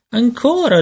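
put-on very high, eager child's cry; gridato. A narrator's reading. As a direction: demand it in a high baby voice. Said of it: "le gridò". (grido acuto da bimbo)

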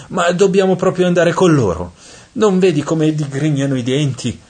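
high-pitched, worried questions; anxious pleading. A narrator's reading. Dante begs Virgil to avoid the demons, anxious.

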